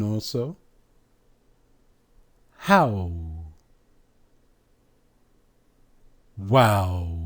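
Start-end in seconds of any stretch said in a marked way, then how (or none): 2.6-7.2 How and Wow with drawn-out "ow"; guttural pronunciation. Shown as a demonstration. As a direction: let How and Wow fall into a long "oo" glide. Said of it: "words that have this guttural pronunciation".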